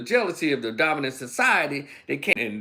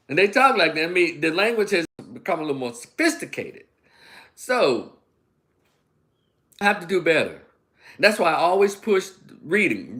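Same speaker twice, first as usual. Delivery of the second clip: normal talking voice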